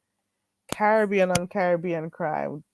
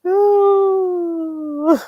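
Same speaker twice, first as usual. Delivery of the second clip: laughing ooh; 10 semitones higher, 5 dB louder than usual. Real, not acted.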